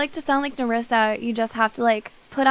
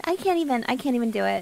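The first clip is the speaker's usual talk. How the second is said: usual speaking voice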